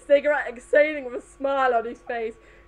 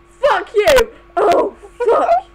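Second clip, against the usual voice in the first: higher, louder hammed-up cries; loud mock cries; 8 semitones higher, 9 dB louder, much slower than usual. Trixie heard through the wall by Rayo, hammed-up loud cries. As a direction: belt it out like she's being overheard.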